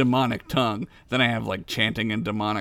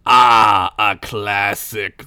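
cold rasping evil emperor; 5 semitones lower, 6 dB louder, much slower than usual. Acted. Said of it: "the Dark Lord said, laughing".

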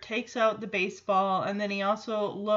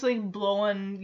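ordinary talk

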